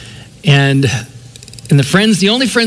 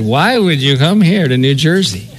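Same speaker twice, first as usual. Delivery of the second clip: mocking, disbelieving sneer; sarcastic. Locals scoffing at a Californian moving to New Jersey, sarcastic. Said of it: "everybody said it with this sarcastic".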